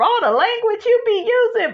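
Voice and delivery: high, quick mock viewer voice. Mimicking viewers calling her out, high and quick. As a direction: tease herself in the viewers' voice. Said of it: "I know y'all like".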